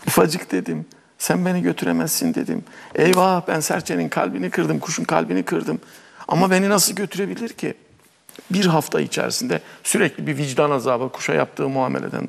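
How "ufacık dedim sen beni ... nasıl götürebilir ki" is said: lightly voiced girl, higher pitch; remorseful young girl. Girl who hurt the sparrow's feelings, mildly remorseful. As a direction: fret aloud with mild regret.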